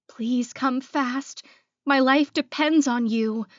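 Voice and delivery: high, voiced plea, starting slow; whispered plea. Woman alone begging her rescuer to come fast, desperate. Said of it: "I whispered".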